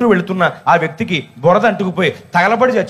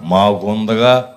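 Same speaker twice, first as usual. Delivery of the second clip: much lower, slow, put-on old woman's voice; old woman's reply; 11 semitones lower, much slower than usual. Preacher imitating the absent old woman, low and slow. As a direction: play the stubborn old lady, low and dragging. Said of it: "అంది".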